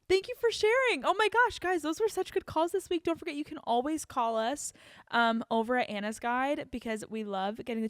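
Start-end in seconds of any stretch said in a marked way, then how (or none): 0.1-3.0 very high, loud and gushing; thrilled gasping thanks; delighted. Real, not acted. Host gasps, thanks caller.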